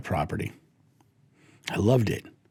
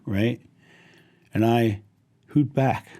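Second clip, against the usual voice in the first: ordinary talk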